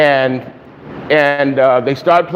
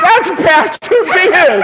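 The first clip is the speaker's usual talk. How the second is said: laughing disbelief; 9 semitones higher, slower than usual. Retold or imitated. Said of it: "And I'm like".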